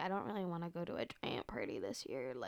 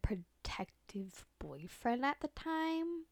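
whispering; 4 semitones higher, much slower than usual. Real, not acted. Whispered aside about boyfriend.

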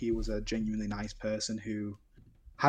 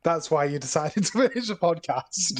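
laughing; 6 semitones higher, 5 dB louder than usual. Real, not acted. Laughing at co-host's excuse.

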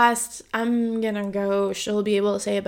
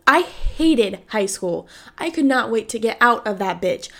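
ordinary talk

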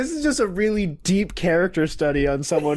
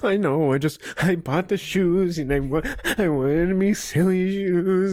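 whiny, faltering mock sob; whining upset; 2 semitones higher than usual. Guy who bought the shoes complaining to boss, whining upset. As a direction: whimper it, stretching the words.

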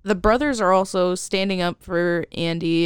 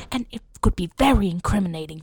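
ordinary talk

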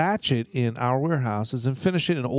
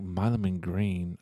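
quiet and drawn out, as it sinks in; slow, hushed realization; slow dawning realization; 6 semitones lower, 6 dB quieter, slower than usual. Retold or imitated. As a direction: murmur the name slowly as the penny drops. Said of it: "I'm like".

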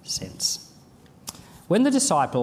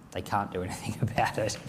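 whispered aside; 4 semitones lower, 6 dB quieter, faster than usual. Real, not acted. Wry aside.

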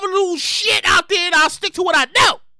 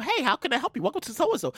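softer, mock-polite greeting; cheery phone rep; 3 semitones lower, 9 dB quieter than usual. Call-center rep greeting the caller, softly polite. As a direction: answer like a bright call-center agent. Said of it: "they get on the phone".

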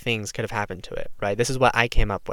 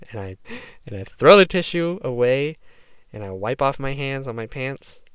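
ordinary talk, nothing marked

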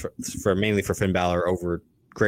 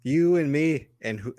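hammed-up Irish brogue, higher and slow, breaking into a laugh; Irish accent impression; 5 semitones higher than usual. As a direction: play the cocky Irish brawler in a mock brogue. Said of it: "we didn't bring you on to do accents".

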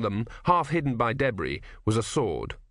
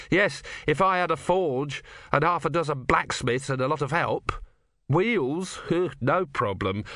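lightly voiced sarcastic craftsman, pitched up; a touch of higher-pitched sarcasm; 5 semitones higher than usual. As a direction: reel off the impossible needs with mild scorn.

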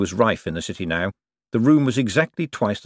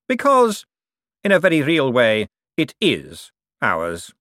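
smooth, self-important Cosmo; 6 semitones higher, 4 dB louder than usual. Acted. Cosmo explains "our bank" to Bent, smooth and self-important. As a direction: draw it out grandly, then settle. Cosmo continues, narrator voicing him.

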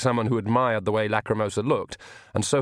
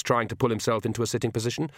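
ordinary delivery, nothing marked

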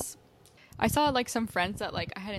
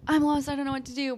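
quick, raised mock panic; mock panicked lost person; 5 semitones higher, 7 dB louder, faster than usual. Friend mocking how lost twenty-somethings sound, quick panic. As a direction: imitate someone whining they're lost. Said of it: "how people are like".

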